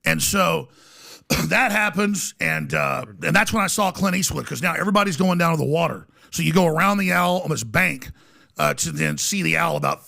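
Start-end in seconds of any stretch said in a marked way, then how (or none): none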